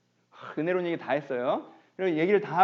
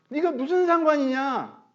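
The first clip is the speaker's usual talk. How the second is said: high emphatic rebuke; 9 semitones higher, 4 dB louder, slower than usual. Shown as a demonstration. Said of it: "다른 사람이 무슨 상관이냐?".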